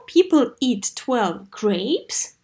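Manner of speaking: slow model reading. Shown as a demonstration.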